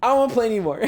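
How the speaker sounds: high, whiny mock child voice; imitating scared little kid. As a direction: play his younger self ready to quit. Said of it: "I was like".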